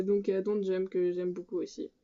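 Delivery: usual speaking voice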